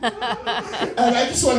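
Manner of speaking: high, slow laughing mock groan; giggly put-on old voice; laughing impression. Mock old-person sound, laughing.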